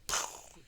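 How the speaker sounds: voiceless puff of air; poof sound. Retold or imitated. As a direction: blow out a sudden breathy poof. Imitates sudden appearance.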